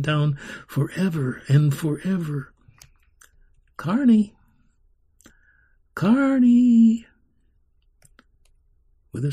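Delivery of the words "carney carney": high call, growing louder and longer. A narrator's reading. Man calling the girl back from Carnival Land, high insistent call. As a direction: call her name high, stretching it the second time. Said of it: "she still heard him calling".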